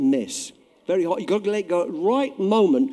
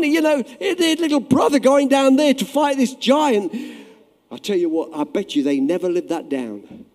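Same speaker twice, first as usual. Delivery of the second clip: ordinary talk, nothing marked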